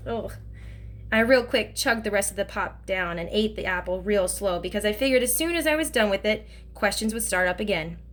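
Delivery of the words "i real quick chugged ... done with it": plain reading voice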